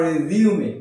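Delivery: রিজিউমে said "rez-yu-me"; রিজিউমে with z and a voiced "me" ending; alternative correct pronunciation. Shown as a demonstration. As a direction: sound the final e in রিজিউমে. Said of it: "এর উচ্চারণ হচ্ছে".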